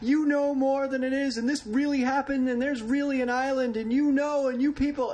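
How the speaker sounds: high, loud frantic ranting woman; crazy woman ranting. Retold or imitated. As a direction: shriek it like an unhinged fan. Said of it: "started going".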